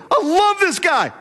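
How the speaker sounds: a preacher admiring Daniel, gushing; gushing admiration. Real, not acted. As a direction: savor the words, thrilled.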